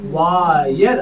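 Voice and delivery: वायर said as wire, rising; वायर, vay-er. Shown as a demonstration. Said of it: "ये बनेगा अपना बेटा".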